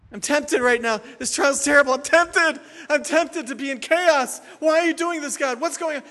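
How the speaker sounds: high, urgent plea to God; distressed complaint. Acted. Preacher acting a tempted believer questioning God, distressed. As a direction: cry out as if overwhelmed by a trial.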